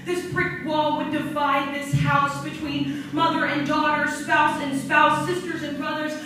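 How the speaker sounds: spoken-word poem, building from measured to loud and fervent; slam poetry recitation. Acted. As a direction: perform it like a slam poet rising to a passionate climax. Performs her slam poem.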